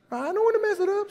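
high and quick, fretful. Retold or imitated. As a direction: squeak it out, worried about singing. Worried about singing badly.

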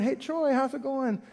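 acted small talk with a buddy; friendly conversation. Preacher catching up with his friend Troy, friendly and chatty. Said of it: "prayer is that conversation".

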